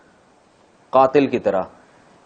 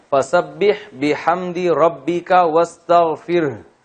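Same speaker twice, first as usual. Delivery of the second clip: slow, melodic tajweed recitation; قرآنی تلاوت کا انداز; Quran recitation; 4 semitones higher, much slower than usual. Shown as a demonstration. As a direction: chant the verse slowly with a held tune. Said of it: "واستغفر یوں پڑھ لیتے ہیں".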